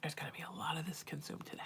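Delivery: soft, fast whisper; whispered aside. Real, not acted. Psychic muttering to herself between readings, whispered. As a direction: let it slip out as a private whisper.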